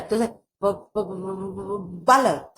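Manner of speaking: repeating the first sound of बालक before saying it; stammered बालक; stammering demo. Shown as a demonstration. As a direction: stutter ब- ब- ब- ब- then बालक. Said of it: "जैसे हम कहना चाहें कि".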